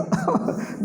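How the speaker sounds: short high breathy laugh. Real, not acted. Teacher laughing about an angry God, high laugh. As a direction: let a quick high laugh escape.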